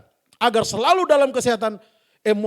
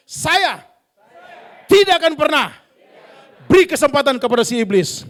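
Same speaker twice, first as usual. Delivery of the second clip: declaration; 7 dB louder than usual. Acted. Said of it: "sama-sama bilang dengan saya".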